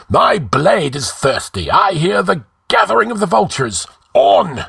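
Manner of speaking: high, bloodthirsty rallying cry; fervent cry. A narrator's reading. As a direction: declaim it high, rising to a shouted command. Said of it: "cried Rinaldo".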